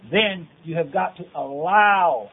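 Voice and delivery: "allow" drawn out slowly; stress on allow. Real, not acted. Preacher urging listeners to let God prepare them, stressing "allow". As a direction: lean hard on "allow", stretching its second syllable. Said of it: "I emphasize the word allow".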